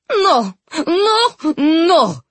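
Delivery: high, loud shrieked refusal; wild rage, near a wail; raging. A narrator's reading. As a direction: shriek in a furious tantrum. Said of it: "she raged".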